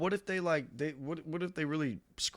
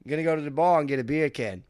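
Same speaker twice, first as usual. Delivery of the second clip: Australian accent; 5 semitones higher, 8 dB louder than usual. Shown as a demonstration. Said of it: "in an Australian accent".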